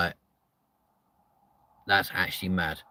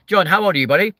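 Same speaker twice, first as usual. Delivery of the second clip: high, loud question; loud high call to a viewer; 10 semitones higher, 7 dB louder, much faster than usual. Real, not acted. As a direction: call across to John. Calling out to chat member.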